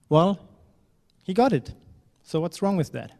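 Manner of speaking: ordinary delivery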